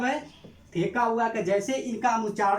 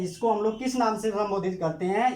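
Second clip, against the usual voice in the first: ordinary talk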